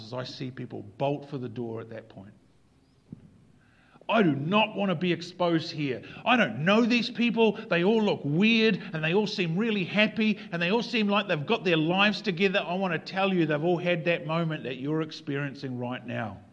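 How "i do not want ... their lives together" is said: acted panicked protest. Acted panicking churchgoer wanting to bolt.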